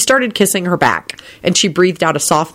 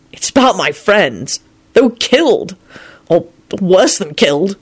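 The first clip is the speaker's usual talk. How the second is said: bitter, slightly raised man's voice; angry; 3 semitones higher than usual. A narrator's reading. Lupin telling of his killed friends, angrily. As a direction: add it with understated anger. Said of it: "he added angrily".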